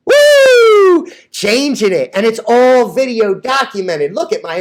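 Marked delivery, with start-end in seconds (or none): 0.1-1.1 high, loud celebratory whoop; excited whoop. Real, not acted. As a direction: let out a joyful yell. Celebrating good news.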